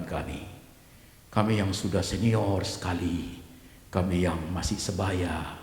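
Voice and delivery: low, measured prayer with swells on key words; leading prayer. Acted. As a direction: lead the congregation earnestly to God. Leads the congregation in prayer. (doa yang khidmat dan khusyuk)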